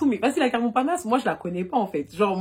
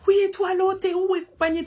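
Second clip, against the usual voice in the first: frantic; 11 semitones higher, 4 dB louder than usual. Retold or imitated. Frantic answer on phone.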